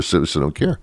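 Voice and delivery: ordinary delivery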